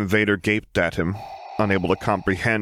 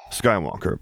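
ordinary delivery, nothing marked

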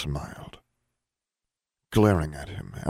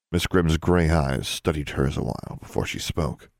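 plain reading voice

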